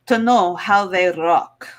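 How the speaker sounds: put-on thick Russian accent, deliberate; Russian contestant sizing up the men; heavy Russian accent. Miss Russia.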